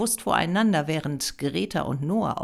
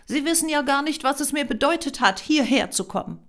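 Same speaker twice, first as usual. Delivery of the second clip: hurt, raised-voice objection; upset protest; 10 semitones higher, 5 dB louder, faster than usual. A narrator's reading. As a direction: plead your case indignantly. Upset applicant protests being shushed.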